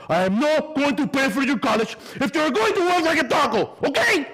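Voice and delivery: high, loud angry father; furious ultimatum. Retold or imitated. Dad threatening to stop paying for college, shouting high. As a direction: lay down the law, shouting.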